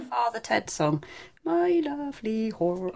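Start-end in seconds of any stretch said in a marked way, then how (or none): 1.4-2.9 slow, high Irish lilt on the title; lazy Irish lilt, dragging it out slowly; mock Irish accent. Co-host sings the Father Ted title in mock Irish. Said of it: "drift into an Irish accent".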